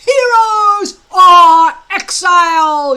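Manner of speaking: slow, high, very loud battle cry; yell. Acted. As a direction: bellow it at the recruits. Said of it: "yelled Gobber the Belch".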